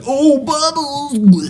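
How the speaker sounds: very high, stretched-out squeal; choking on bubbles. Acted. Showing soda burning the throat, high drawn-out mock squeal. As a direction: squeal it slowly, overwhelmed by fizz.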